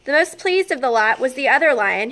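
usual speaking voice

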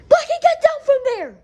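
sharp high yell to get down; high scolding shout at the climbing pet. Acted. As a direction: call him down loudly, high.

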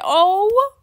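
loud, high rising wail; coo-wail as if overcome with emotion; mock happy crying. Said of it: "I'm gonna cry".

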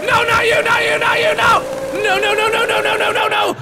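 high, sustained panicked cry. Real, not acted. Player pleading as an enemy appears, panicked. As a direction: wail a frantic string of refusals.

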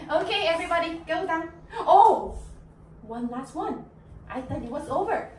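ordinary delivery

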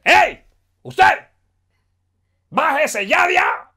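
loud high police order; angry policeman shout. Retold or imitated. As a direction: bark orders like a cop. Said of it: "llegó un policía y le dijo".

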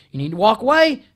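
telling the coworker to leave, loud and firm; firm loud command. Retold or imitated. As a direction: order her out, pointing at the door. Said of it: "I just repeated that".

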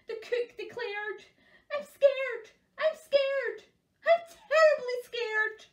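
high, loud cries of a panicky cook; scared cook. Acted. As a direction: wail it like a frightened wimp in a story.

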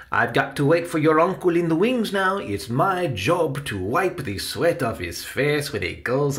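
put-on a dresser explaining his job, then cautioning a child; Salvador, busy and caring. Voices Salvador leaving for the wings.

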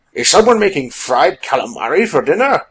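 loud, swooping character voice; jaunty William. A narrator's reading. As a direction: ask loudly, relishing the thought of dinner.

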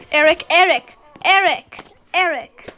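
loud calling, voice rising; calling out loudly. Real, not acted. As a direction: call his name across the room. Calling out to game character.

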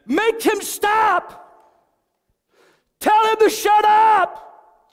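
high, very slow, shouted demon voice; screaming demon through the woman; demon's man voice. Retold or imitated. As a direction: shriek it like a possessed woman. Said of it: "In a man's voice".

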